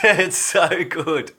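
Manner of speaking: high, slow and laughing; amused, laughing it off. Real, not acted. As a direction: drag it out through a laugh. Laughing reply to viewer comment.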